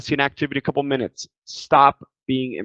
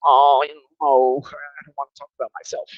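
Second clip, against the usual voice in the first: shy cat voice. Pretend. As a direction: play the shy cat who won't talk. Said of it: "you're gonna feel like this cat".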